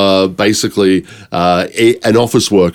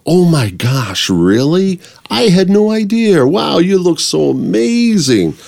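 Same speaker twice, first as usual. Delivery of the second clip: gushing American, higher and drawn out; American accent; 9 semitones higher, slower than usual. Shown as a demonstration. Actor demonstrating how Americans gush, American accent. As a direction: stretch the middles of words, American style. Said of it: "long the middle of it".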